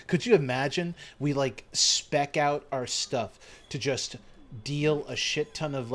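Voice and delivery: usual speaking voice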